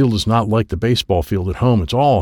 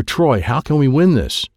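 ordinary delivery, nothing marked

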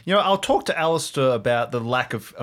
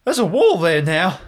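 rising panicked disbelief, getting louder; alarmed; 9 semitones higher, 6 dB louder than usual. Acted. A player's character alarmed at the wall that appeared. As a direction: sound rattled at the new wall. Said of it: "I was like".

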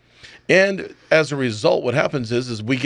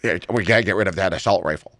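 lightly voiced, lower and quick mock quote; mock gun-control advocate; 5 semitones lower, faster than usual. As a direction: parody them in a low, hurried voice. Mocking those who misname the rifle.

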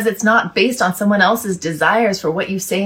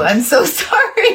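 high, laughing through sorry; laughing apology; 9 semitones higher, 4 dB louder than usual. Real, not acted. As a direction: apologize mid-giggle. Apologizing while laughing, distracted.

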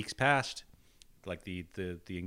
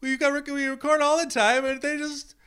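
high, loud mock sobbing rant; hysterical; 10 semitones higher, 7 dB louder than usual. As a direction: wail it like near tears. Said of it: "Lloyd is hysterical".

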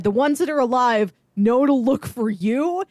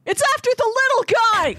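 mock high-pitched yell; 14 semitones higher, faster than usual. Podcaster retelling a subtitled Hong Kong crowd, high mock yell. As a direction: shriek it like a panicked bystander in a monster movie. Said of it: "subtitles is somebody yelling".

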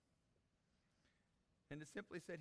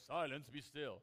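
loud, booming mock God voice; put-on God voice; 6 dB louder, slower than usual. Shown as a demonstration. Preacher shows the voice Jesus didn't use, mock God voice. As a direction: put on a grand God voice, louder. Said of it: "putting on his God voice".

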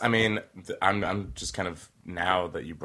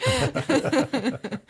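high burst of laughter; 5 dB louder than usual. Real, not acted. Laugh after the quote.

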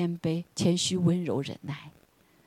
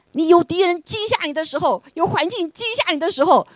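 higher, emphatic parallel lines; preaching; 12 semitones higher than usual. Acted. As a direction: hammer each 惊吓 phrase home. (高调强调地讲)

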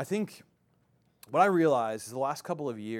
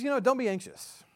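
much higher, soothing voice of Jesus; Jesus gently speaking; 11 semitones higher, 4 dB louder than usual. Retold or imitated. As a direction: voice Jesus calming anxious people, pitched up high. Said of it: "he says".

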